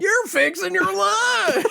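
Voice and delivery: very high, slow Southern squeal; mock Southern accent. Host mimicking his co-host, in a squealed Southern accent. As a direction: imitate the twang way up high, stretching LUNCH.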